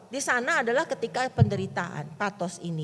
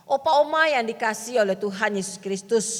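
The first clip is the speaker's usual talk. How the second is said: ordinary delivery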